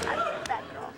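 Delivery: high, breathy playful tease; playfully echoing the tease; mock bad girl. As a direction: purr it back as a coy joke. Echoing host's teasing "bad girl.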